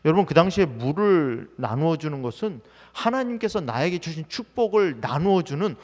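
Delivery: usual speaking voice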